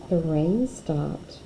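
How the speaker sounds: ordinary delivery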